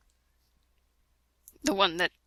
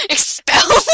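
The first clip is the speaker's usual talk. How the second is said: higher, louder laughing question; laughing disbelief; 8 dB louder than usual. Real, not acted. Reading a bad fanfic aloud, incredulous laughing repeat. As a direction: laugh out the word Expelled. Mocking misspelled word while laughing.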